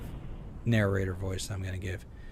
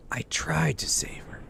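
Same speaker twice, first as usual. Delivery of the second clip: hushed, haunted diary whisper; whispered narrator voice; 4 semitones higher, 3 dB quieter than usual. Acted. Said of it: "my best impression of, of, of narrator voice".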